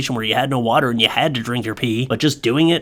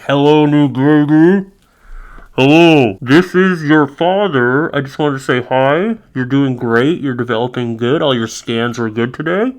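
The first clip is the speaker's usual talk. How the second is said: louder, slow, gentle dad voice into the womb; father talking to fetus; 5 dB louder than usual. Pretend. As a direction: greet the unborn baby slowly. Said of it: "then be like".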